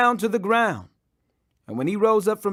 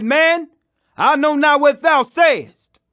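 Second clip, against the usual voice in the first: much higher and louder, slow and insistent; desperate denial; 6 semitones higher, 7 dB louder, slower than usual. Acted. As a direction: deny it with everything you have. Peter's third, desperate denial.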